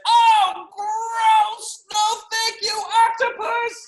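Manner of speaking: very high, squealed refusal; disgusted boy's yell. Acted. Boy refuses the egg salad bath, high disgusted shout. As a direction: shriek it in comic horror.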